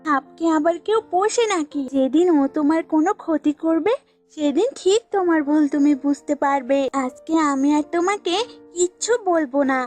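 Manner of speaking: lightly voiced offended goat; huffy goat. Goat quits warning the old woman, mildly huffy. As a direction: give up arguing in a mild huff.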